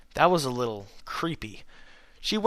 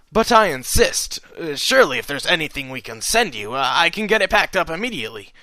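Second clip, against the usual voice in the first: insistent, quick and eager; eager shopkeeper; 4 semitones higher, 5 dB louder than usual. A narrator's reading. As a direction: press the offer, hurrying. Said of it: "he continued to assure".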